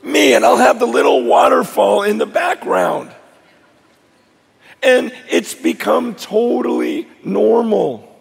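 loud, high preaching at full force. Acted. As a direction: preach it out to the crowd.

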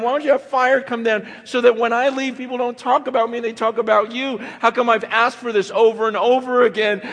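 high, loud, exasperated plea to God; frustrated loud prayer. Retold or imitated. Preacher retells demanding a miracle from God, loud and frustrated. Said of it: "almost frustrated with God".

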